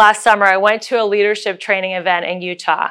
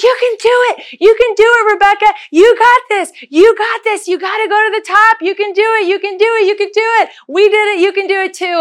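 high, bright mock cheering from strangers; cheering crowd; 11 semitones higher, faster than usual. Hikers coming down cheer Rebecca up the mountain, high excited cheering. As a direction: cheer her on like a hiking crowd. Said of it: "And they're like".

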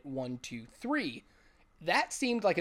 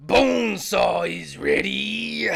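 loud, slow wrestler boast; Bonesaw growl; 4 semitones higher, 10 dB louder, much slower than usual. Retold or imitated. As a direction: drag it out like a ring villain. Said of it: "Best line ever".

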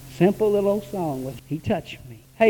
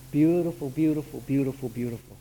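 softer and lower with each word, trailing off; fading reverent repetition; 7 semitones lower, 7 dB quieter than usual. Real, not acted. Preacher recalling the service, fading to hush. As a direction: savor it until it's almost gone.

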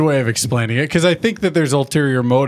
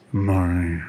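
much lower and softer, one-word morning grunt; grumpy grunt; 6 semitones lower, 5 dB quieter than usual. Retold or imitated. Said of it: "you're gonna be like".

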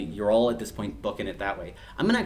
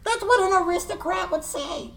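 very high goblin voice; high-pitched little creature accusing; goblin retort. Acted. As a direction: squeal it back like a suspicious goblin. Game master answers as a goblin.

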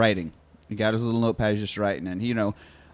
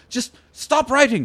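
higher, slow, pleading "Just stop writing"; exasperated plea; 10 semitones higher, slower than usual. Acted. Character fed up with note-taking.

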